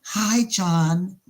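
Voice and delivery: lilting imitation of a woman's voice. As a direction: imitate a woman calling sweetly from afar. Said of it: "you hear this woman's voice say".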